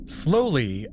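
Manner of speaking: plain reading voice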